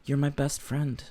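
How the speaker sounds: soft, hushed sincerity; tender, earnest. Retold or imitated. JCVD holding his friend's hand, soft and earnest. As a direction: say it gently, close up. Tender bedside declaration retold.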